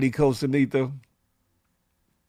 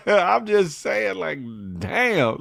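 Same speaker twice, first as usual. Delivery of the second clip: normal talking voice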